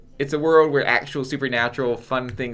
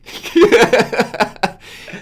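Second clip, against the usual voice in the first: loud laugh; 8 semitones higher, 7 dB louder than usual. Real, not acted. Loud laugh at Batman joke.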